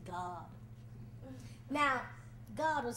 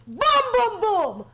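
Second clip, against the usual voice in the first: pounding knock; 6 semitones higher, 15 dB louder than usual. Acted. Woman pounding on God's door, very loud knocks. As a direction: boom boom boom, hammered out very loud.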